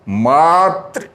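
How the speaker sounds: मातृ with a long, drawn-out first syllable; stress in मातृ. Shown as a demonstration. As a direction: lean slowly on the मा of मातृ. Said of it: "बलाघात हो रहा है".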